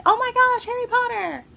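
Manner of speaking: very high, thrilled fan squeal; excited squeal. Retold or imitated. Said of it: "They just saw Bellatrix and was like".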